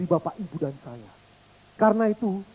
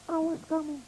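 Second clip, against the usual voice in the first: much softer, far away; echo of the threat; 9 semitones higher, 8 dB quieter than usual. Pretend. Echo returning the threat, faint and distant. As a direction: fade it back as an echo.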